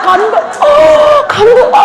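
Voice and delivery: hammed-up choked-up gasps of awe; 흉내 낸 울먹이는 감탄; mock tearful foreigner. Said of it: "이러면서 울면서 막 울먹이면서".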